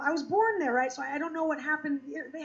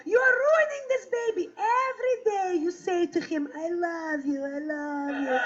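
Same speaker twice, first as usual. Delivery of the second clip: Russian mother scolding. Retold or imitated. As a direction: imitate an exasperated Russian mother. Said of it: "Here's what my mother said to me".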